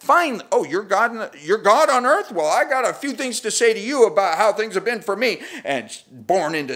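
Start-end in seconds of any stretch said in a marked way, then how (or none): 0.0-5.4 high, loud indignant challenge; hammed-up resentful outburst; mock bitter retort. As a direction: hurl it at him with sarcasm. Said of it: "She could have said".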